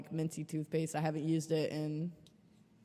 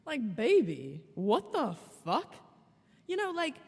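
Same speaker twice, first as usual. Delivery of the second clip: slow incredulous what the fuck; 5 semitones higher than usual. Real, not acted. Vlogger reacting to the match, slow incredulous disbelief. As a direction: let disbelief stretch the words. Exasperated at him.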